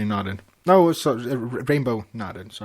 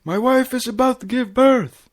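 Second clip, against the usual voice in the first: frantic; 12 semitones higher, 5 dB louder than usual. A narrator's reading. Flash frantic at front desk.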